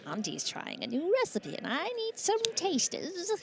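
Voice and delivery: put-on high, squealing baby talk aunt; Auntie Goblin voice. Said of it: "You got a great Auntie Goblin voice".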